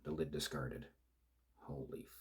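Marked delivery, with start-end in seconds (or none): 1.6-2.1 hushed, unvoiced curse; shocked mutter, almost no voice. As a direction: breathe it out under your breath. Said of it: "I muttered".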